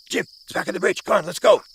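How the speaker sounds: urgent call. Retold or imitated. Urging his friend Jim back to the bridge, eager urgent call. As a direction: call him to hurry back.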